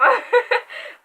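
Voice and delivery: high, loud laugh at herself; burst of laughter. Real, not acted. Reviewer laughing at her own dark joke, loud laugh.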